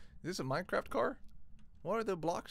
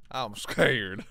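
sudden Texan twang, louder; Texan accent; 3 semitones lower, 9 dB louder than usual. Real, not acted. Streamer to chat, slipping into a Texan accent. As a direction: drawl scared like a Texan. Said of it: "turned into a Texan right there".

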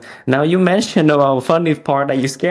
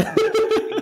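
high giggle at his own story; a bright burst of laughter; 5 dB quieter than usual. Real, not acted. After telling of his trainer, a high laugh.

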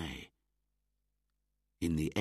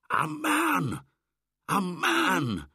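high, loud outraged yelp; angry cry; 7 dB louder, slower than usual. Acted. Young wolves crying out against Mowgli, angry. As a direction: yelp it out at the pack. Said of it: "cried most of the younger wolves angrily".

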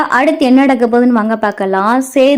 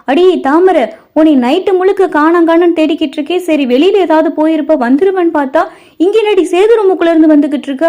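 high, raised-voice questioning; alarmed scolding; 7 semitones higher, 5 dB louder than usual. A narrator's reading. Mother questions daughter found leaving Sethu's room, alarmed scolding. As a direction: narrate a mother's agitated scolding.

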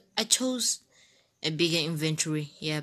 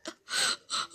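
gasp; 7 dB quieter than usual. Real, not acted. Gasp after the kill.

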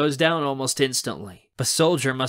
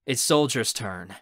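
ordinary delivery

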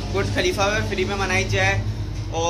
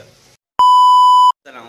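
long, high, loud greeting call; drawn-out shout; 15 dB louder, much slower than usual. Real, not acted. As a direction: stretch the salaam out like a call to the crowd.

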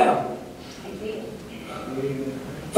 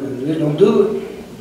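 slow earnest plea; 4 semitones lower, much slower than usual. Real, not acted.

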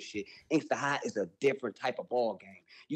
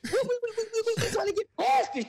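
laughing. Real, not acted. Still laughing, pushing on.

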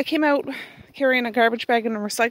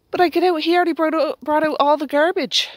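ordinary delivery

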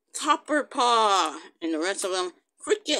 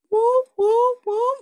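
loud, high-pitched whooping; high whoops; 12 semitones higher, 6 dB louder, slower than usual. Real, not acted. Reacting to Blossom Paw jumping, high whoops. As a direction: whoop three times, high and sudden.

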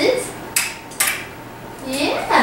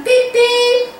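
high beep-beep; two bright high-pitched toots; car horn; 11 semitones higher than usual. Shown as a demonstration. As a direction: imitate a toy car honking. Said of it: "there's the car".

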